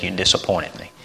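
soft, heavy words from a let-down dad; hushed letdown; quiet disappointed father. Retold or imitated. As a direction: say it low and hurt. Father quietly rebuking son.

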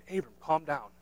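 hushed and measured, stopping him gently; calming wife. Acted. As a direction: quietly settle an over-excited husband. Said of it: "Sarah just stopping him and saying".

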